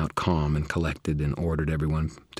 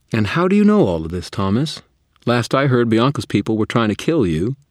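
loud, pointed, suspicious questioning; sharp challenge; 7 semitones higher, 9 dB louder than usual. Acted. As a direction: call him out on it.

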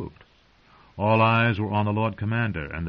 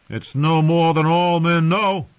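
loud, slow, higher taunt; defiant shout; 7 semitones higher, 6 dB louder than usual. Acted. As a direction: hurl the insult back in his face. Carl defiantly taunting Craster.

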